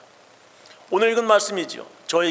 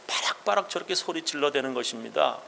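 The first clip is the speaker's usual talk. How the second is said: whisper; 3 semitones lower, 7 dB quieter than usual. Real, not acted. Whispered aside.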